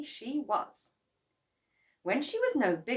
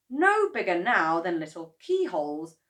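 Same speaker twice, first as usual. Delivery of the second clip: usual speaking voice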